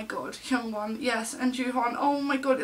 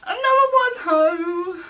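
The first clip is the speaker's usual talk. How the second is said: loud, high, drawn-out squeal; thrilled discovery; 9 semitones higher, 9 dB louder, slower than usual. Real, not acted. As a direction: sing out the surprise slowly and loudly. Finds another favourite card.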